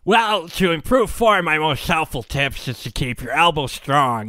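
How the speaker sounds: slow, put-on nerd voice; know-it-all nerd. Know-it-all swimmer answering an obvious question, nerd voice. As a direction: lecture like an insufferable nerd. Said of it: "I'll do the know-it-all nerd voice".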